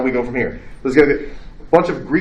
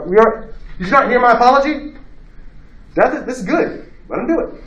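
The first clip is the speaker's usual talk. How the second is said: Socrates scolding mourners; 9 semitones higher than usual. Retold or imitated. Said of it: "He's like".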